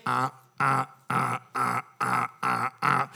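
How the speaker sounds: low, quiet repeated "uh" buzzes; imitated rejection buzzer. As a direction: buzz out each rejection, low and even.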